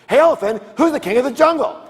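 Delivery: high, loud demand from a lion; insistent lion. Acted. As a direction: call out, demanding to be answered. Lion challenging the elephant again.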